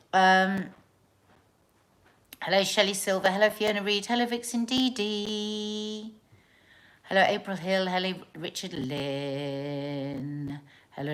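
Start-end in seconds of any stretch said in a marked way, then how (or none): none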